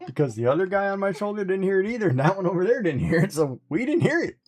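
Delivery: silly excuse. Acted. Husband blames shoulder voices.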